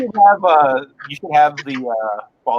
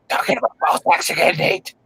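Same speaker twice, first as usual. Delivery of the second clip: hushed, rough mock scolding, quickly; mock scolding wife; 6 semitones higher than usual. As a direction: imitate a wife's exasperated telling-off. Imagined wife scolding husband.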